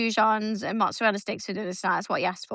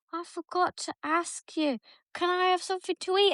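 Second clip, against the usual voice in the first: imitating her son; 7 semitones higher, 3 dB quieter than usual. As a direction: drag it out like a sleepy hungry boy. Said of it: "he comes in and he's like".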